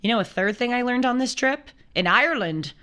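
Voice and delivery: normal talking voice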